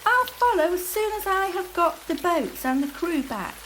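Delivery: put-on high, loud boy's call; Jack calling. A narrator's reading. Jack telling Matt to go ahead, calling loudly. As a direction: shout it back over the wind.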